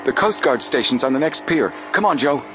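rally a partner to hurry along; exclaiming. A narrator's reading. Frank urging Joe to the next pier, exclaiming. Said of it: "Frank exclaimed".

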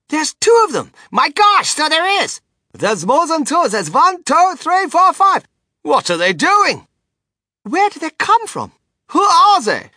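high, loud amazed onlookers; excited shouting. A narrator's reading. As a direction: shout out each new sighting. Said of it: "everybody started shouting at once".

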